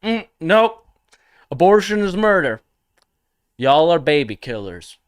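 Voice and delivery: high, loud, blunt mock-macho verdict; over-the-top bro declaring his stance; mock Chad. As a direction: play a smug Chad laying down the law. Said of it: "you could just be a Chad and be like".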